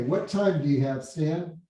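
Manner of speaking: ordinary delivery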